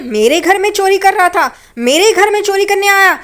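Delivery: high, indignant acted rant; outraged scolding. [name] scolds the thief she beats, acted outrage.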